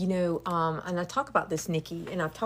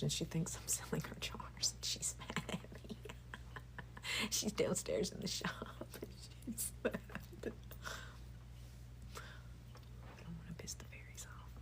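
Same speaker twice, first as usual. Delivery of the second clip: real whisper; 14 dB quieter than usual. Real, not acted. Confiding to viewers about her mad daughter, whispered. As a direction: hush it conspiratorially, half laughing. Hiding from upset daughter downstairs.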